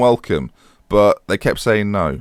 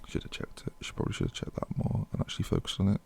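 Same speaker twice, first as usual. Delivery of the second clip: muttered to himself; 2 semitones lower, 17 dB quieter than usual. Real, not acted.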